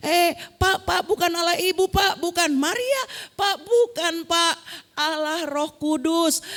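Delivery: high, loud objection to teacher; fiery protest. Retold or imitated. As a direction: burst in to correct him, insistently. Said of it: "Kok membara marah sekali ya".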